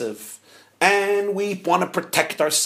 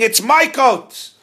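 slow, loud, higher yell of my coat; mock scream; 8 semitones higher, 7 dB louder, slower than usual. Rabbi imitating the second quarreler, slow loud yell. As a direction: shout it out, drawn out. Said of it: "You were screaming".

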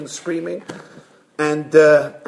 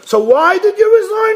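high, loud burst of disbelief; exasperated question; 6 dB louder than usual. Acted. Board finally asks the rabbi, exasperated. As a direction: blurt it out, at a loss.

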